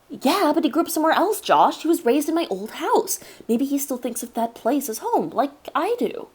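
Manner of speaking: earnest kid, lightly voiced; child voice. Narrator as child self.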